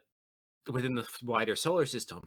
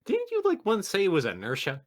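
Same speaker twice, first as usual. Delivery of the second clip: surprised; 9 semitones higher, 6 dB louder than usual. Acted. Teasing challenge to the commissar.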